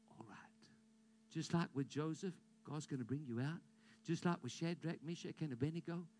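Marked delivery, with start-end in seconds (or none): 0.1-0.7 whisper. Acted. Reassuring whispered ending.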